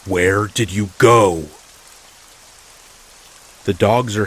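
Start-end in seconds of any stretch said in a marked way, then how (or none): 0.1-1.5 loud, drawn-out yell. A narrator's reading. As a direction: holler it slowly as the angry neighbor. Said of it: "and then he yells".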